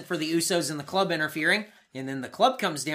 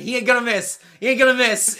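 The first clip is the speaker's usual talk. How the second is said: loud, higher mock scream, repeated; 6 semitones higher, 8 dB louder than usual. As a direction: yell it like a fired-up hype man. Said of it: "Enzo starts screaming".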